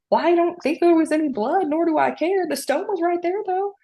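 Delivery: indignant high voice. Retold or imitated. Indignant reply to receptionist.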